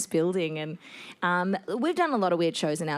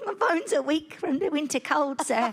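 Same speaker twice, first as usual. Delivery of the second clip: frail pleading orphan, a bit higher; plucky hopeful orphan; 5 semitones higher than usual. Pretend. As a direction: whimper about the winter cold. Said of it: "do a poor orphan voice".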